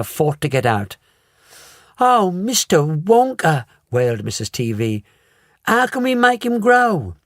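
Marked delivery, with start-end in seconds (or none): 2.0-7.1 wailed. A narrator's reading. Mother wails to Wonka for help. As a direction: wail to him for help.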